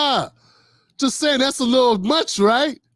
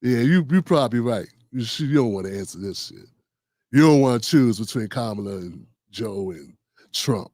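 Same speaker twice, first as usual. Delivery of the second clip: mocking retort; 9 semitones lower than usual. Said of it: "I'm like".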